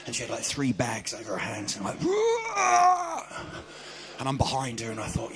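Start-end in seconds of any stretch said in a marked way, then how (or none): 2.0-3.2 high mock yell of effort; strained groan. Old lady lifting bags, a mock strained cry. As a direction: heave it out.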